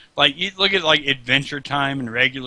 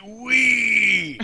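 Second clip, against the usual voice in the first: high playful slide cry; a grown soldier squealing down a slide; gleeful wee; 8 semitones higher than usual. Retold or imitated. Sergeant Slaughter sliding down, squealing a mock wee. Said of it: "be like".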